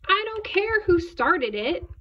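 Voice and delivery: loud, high teacher's command; scolding teacher. A narrator's reading. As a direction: order a pupil like a cross teacher. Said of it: "David's teacher always said".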